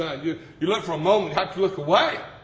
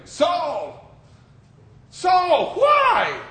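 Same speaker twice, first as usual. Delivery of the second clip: building from a call to a high loud shout; calling out from heaven; 10 semitones higher, 5 dB louder, much slower than usual. Acted. Voice from heaven confronting Saul, building shout. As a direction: cry out his name, rising.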